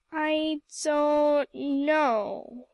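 very slow, drawn-out, unsure; confused. A narrator's reading. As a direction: Emily, caught off guard by Aunt Ruth, confused. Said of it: "said Emily confusedly".

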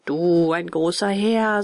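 slow, drawn-out scornful disbelief; mocking. A narrator's reading. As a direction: stretch the words out in mockery. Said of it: "verzog spöttisch das Gesicht". (spöttisch gedehnt)

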